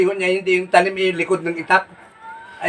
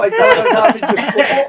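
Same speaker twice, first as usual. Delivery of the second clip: laughing; 12 semitones higher than usual. Real, not acted. Laughing retelling the punchline.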